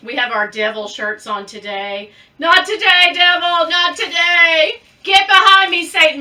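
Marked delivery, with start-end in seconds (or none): 2.4-4.8 loud rebuke. Retold or imitated. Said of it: "My grandma used to say that". 5.0-6.2 louder, higher grandma-style scolding; loud rebuke. Retold or imitated. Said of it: "My grandma used to say that".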